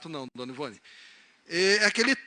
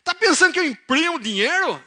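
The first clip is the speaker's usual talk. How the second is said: put-on high, loud Piracicaba accent, indignant; 10 semitones higher, 10 dB louder than usual. Father fuming over a money request, thick Piracicaba accent. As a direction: caricature an angry Piracicaba farmer. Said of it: "Ele era mais piracicabano".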